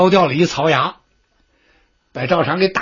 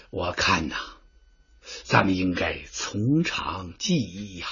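much lower, softer, slow advice; quiet counsel; 10 semitones lower, 10 dB quieter than usual. Acted. Advisor quietly urges the general to plan carefully. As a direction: confide a careful plan in hushed tones.

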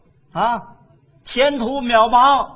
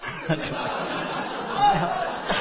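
breathy laugh; 9 semitones higher, 9 dB quieter than usual. Acted. Crushed by her retort.

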